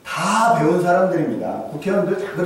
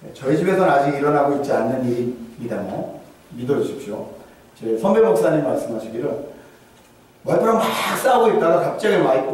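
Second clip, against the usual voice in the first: ordinary talk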